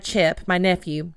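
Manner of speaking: usual speaking voice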